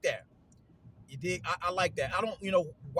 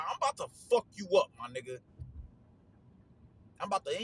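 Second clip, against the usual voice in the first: aggressive trash talk; 3 semitones higher, faster than usual. Retold or imitated. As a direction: spit it out like a fighter hyping himself. Retold Joshua trash talk.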